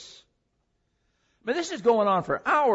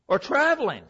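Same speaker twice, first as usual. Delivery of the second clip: mocking; 8 semitones higher, 4 dB louder than usual. Mocking Baal, laughing after.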